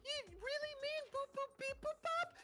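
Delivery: shocked high robot voice. Pretend.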